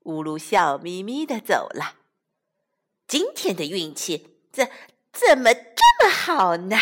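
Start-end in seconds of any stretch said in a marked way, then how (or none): 3.1-6.8 loud delighted question; gleeful. Acted. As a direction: revel in the luck. Wolf rejoices again.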